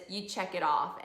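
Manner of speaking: ordinary delivery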